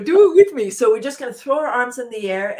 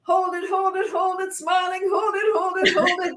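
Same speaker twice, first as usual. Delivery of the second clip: much higher, held-breath hold it; strained hold; 10 semitones higher, 3 dB louder than usual. Shown as a demonstration. Leader holding breath with the group, high strained voice. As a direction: squeeze the words out holding the breath. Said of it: "breathe in".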